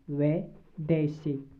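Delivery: वैदेशिक slowly, split after the opening "vai"; slow, deliberate "vai" then "deshik" in वैदेशिक; slow correct pronunciation. Shown as a demonstration. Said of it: "बल्कि वैदेशिक होगा".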